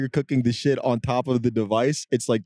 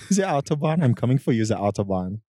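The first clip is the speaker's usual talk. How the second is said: comic bad German accent; hammed-up German accent. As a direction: declare it in a mock German accent. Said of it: "his German accent is fucking groundbreaking".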